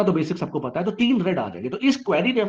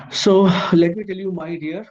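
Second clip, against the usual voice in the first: drawn-out tease; much slower than usual. Acted. Teacher teases a slow reveal.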